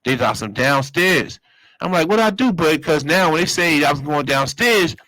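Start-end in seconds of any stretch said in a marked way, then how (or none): 2.1-2.5 alarmed. Retold or imitated. Worried about being summoned.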